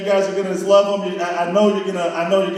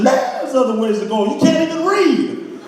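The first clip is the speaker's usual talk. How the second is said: exasperated scolding; 6 semitones higher than usual. Retold or imitated. Scolding friend Eddie.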